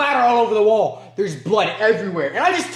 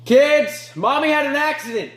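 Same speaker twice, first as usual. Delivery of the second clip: put-on high-pitched call to children; raised, sing-out parental call; calling out to the kids; 7 semitones higher than usual. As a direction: call the kids from another room.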